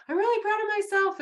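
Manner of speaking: high, loud gushing niece quote; imitating niece's pride. Retelling niece's Facebook post, high and excited. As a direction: beam it out like a proud niece. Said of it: "and she's like".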